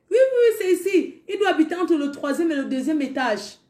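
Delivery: a mamie insisting he's here; insistent mamie. Retold or imitated. Mamie repeating yes.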